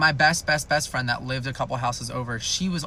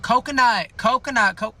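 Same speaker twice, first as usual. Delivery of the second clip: loud, high calling of a name; 9 semitones higher, 6 dB louder than usual. Retold or imitated. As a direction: call the name out across the yard. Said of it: "So she's like".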